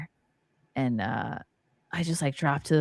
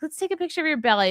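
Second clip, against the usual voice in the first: high, chirpy mock of an excited friend; playfully imitating an eager friend; imitating her friend; 11 semitones higher, faster than usual. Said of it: "You're like".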